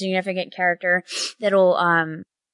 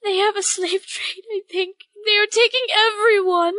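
higher, desperate mother begging; pleading bird mother; 13 semitones higher than usual. Acted. Bird mother begs the adventurers to find her son.

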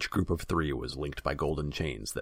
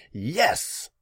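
high, loud burst of fury; furious shout; 11 semitones higher, 8 dB louder than usual. A narrator's reading. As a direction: shout it in boiling anger. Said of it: "he shouted".

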